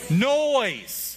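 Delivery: "Noise" called out high and loud; rally the crowd with "Noise"; congregation call and response. Shown as a demonstration. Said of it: "say it again".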